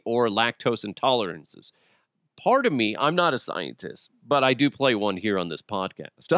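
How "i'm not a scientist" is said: ordinary delivery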